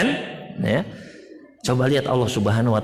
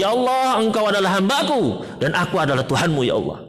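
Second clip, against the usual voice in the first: overjoyed cry; 7 semitones higher than usual. Pretend. Overjoyed traveller cries out.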